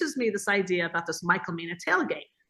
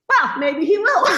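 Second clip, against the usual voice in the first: slow, higher, sly hopeful tease; coy; 7 semitones higher, 6 dB louder, slower than usual. Retold or imitated. Wife answering her friend in Tahoe, slow coy tease. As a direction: let it hang with a knowing grin.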